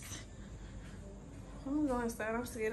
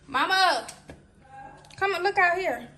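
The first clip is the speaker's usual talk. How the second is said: calling out; 4 semitones higher, 9 dB louder than usual. Real, not acted. Calling mother again.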